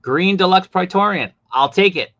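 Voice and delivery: loud, high and eager; excited buyer calling dibs; eager claim. Real, not acted. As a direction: grab it like a prize.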